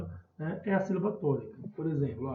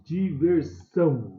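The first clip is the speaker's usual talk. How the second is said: "diversão" slowed, with SÃO punched louder; teacher's slow demo of di-ver-SÃO; stressed last syllable; 5 dB louder, slower than usual. Shown as a demonstration. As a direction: stretch out "diversão", leaning on the final "são". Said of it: "é o são aqui que é a sílaba tônica".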